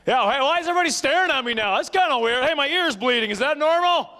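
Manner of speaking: imitating a booming teenage son; loud teen. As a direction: blare it like a teen who can't hear himself. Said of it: "he's so loud".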